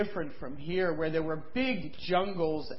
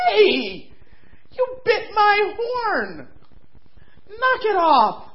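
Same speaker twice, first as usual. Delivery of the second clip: angry rhino; 14 semitones higher, 8 dB louder, much slower than usual. Acted. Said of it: "And Rhinoceros said".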